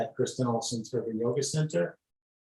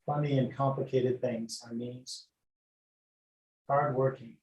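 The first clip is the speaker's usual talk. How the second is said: guided meditation. Acted. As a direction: lull them gently from toes upward. Leads body scan meditation.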